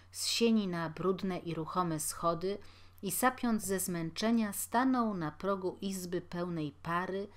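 usual speaking voice

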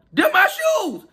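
high indignant cry on Them my shoes; discovering her shoes in his photo, high shout; shocked shout. Real, not acted. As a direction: yell it as the stolen shoes appear. Spotting stolen shoes in photo.

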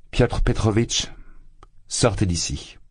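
ordering him out, pale with contained fury; cold anger. A narrator's reading. Dounia orders her suitor out, in cold contained anger. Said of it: "pâle de colère".

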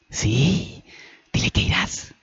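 excited yes into a whisper; whispered, insistent prompting; whispered urging. Acted. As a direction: egg a friend on under your breath. Annie butts into the call.

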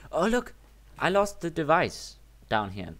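high, loud cry of discovery, slow on the second line; excited discovery. Real, not acted. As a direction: call it out like you just found it. Spots lost device in game.

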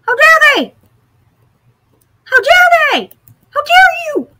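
very high, loud squealed protest; play-outrage in a piercingly high, loud voice; mock outrage. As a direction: squeal indignantly at the top of your voice. Outraged at player taking the map.

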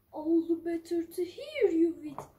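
low, sly wolf voice. Acted. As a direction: purr it like the big bad wolf. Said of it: "said the wolf".

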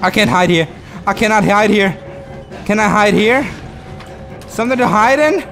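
high-pitched anxious scrambling; frantic panic. Real, not acted. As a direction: search desperately, out of breath. Searching for hiding spot, chased.

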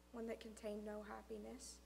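plain reading voice

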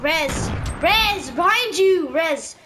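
loud urgent shouts; shouting. Real, not acted. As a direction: yell a warning to a teammate. Warning teammate in a firefight.

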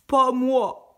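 refus grogné, fort et aigu; le cochon refuse en grognant; cochon grognon. A narrator's reading. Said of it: "grogna le cochon". (le cochon refuse d'aider, grognement sonore)

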